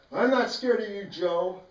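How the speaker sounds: high, loud defiant yell; yelled back. Retold or imitated. The boy yelling defiance back at Joe. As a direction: shout back without flinching. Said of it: "And I yelled back".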